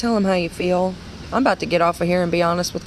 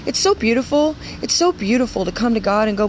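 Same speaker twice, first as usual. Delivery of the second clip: ordinary talk, nothing marked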